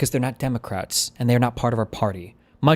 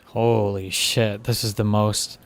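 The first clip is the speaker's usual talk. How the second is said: slow, drawn-out disbelief; long exasperated groan of words; much slower than usual. Real, not acted. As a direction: drag the words out, fed up. Fed-up listener reacts to debate.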